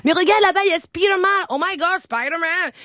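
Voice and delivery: high-pitched mock thrill; excited mother. Mother shows her son Spider-Man at Disney, excited.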